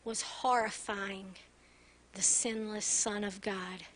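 subdued, reverent pulpit delivery; hushed preaching. Real, not acted. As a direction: let each phrase sink in quietly, with long pauses.

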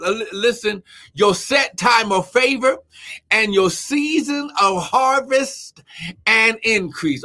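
high-pitched, rousing sermon delivery; fervent preaching. Real, not acted.

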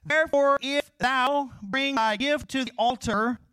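loud, high proclamation that slows on the verse; scripture reading. Acted. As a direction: proclaim the King James verse.

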